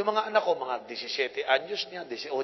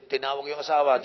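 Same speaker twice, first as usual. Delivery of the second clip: usual speaking voice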